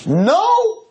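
high, loud insistent no; mock pleading no; imitating an insistent guy; eager no.

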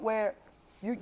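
ordinary talk

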